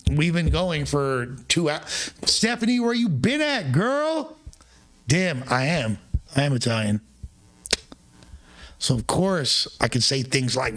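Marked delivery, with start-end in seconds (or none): 2.2-4.4 very high, swooping call to a friend; excited greeting. Real, not acted. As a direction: greet her in a sing-song high pitch. Greeting Stephanie by name, calling out.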